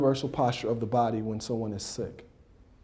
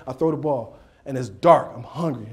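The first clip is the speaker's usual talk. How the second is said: ordinary talk, nothing marked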